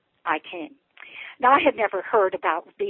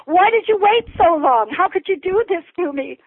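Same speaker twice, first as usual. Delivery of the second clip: high, loud cry of reproach; retold screaming protest; 9 semitones higher, 6 dB louder than usual. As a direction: cry out to God in re-enacted anguish. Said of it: "screaming and crying out to God".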